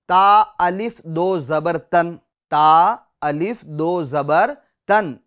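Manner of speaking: slow spelled-out ता अलिफ दो जबर तन, naming the alif; drawn-out wrong spelling that says अलिफ; teacher demos the incorrect spelling. Shown as a demonstration. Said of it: "ये हिज्जे सही नहीं है".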